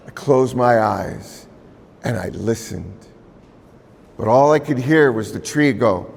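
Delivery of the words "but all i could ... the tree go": ordinary delivery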